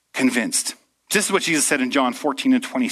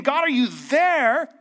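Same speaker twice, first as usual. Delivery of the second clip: high, hurt plea to an absent God; mock desperate prayer; aggrieved prayer; 11 semitones higher than usual. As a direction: complain God is napping. Complaining to God he naps.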